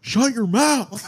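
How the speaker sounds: high, drawn-out mock scolding; mock outrage. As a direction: stretch it out high, hammed-up exasperation. Mock telling-off of interrupting guest.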